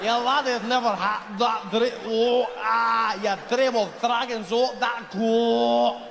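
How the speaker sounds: put-on queasy Scottish accent. Comic mimics Scots trying not to throw up, retching Scottish accent. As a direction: drag out a Scottish brogue as if about to throw up. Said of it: "centuries of people trying not to throw up".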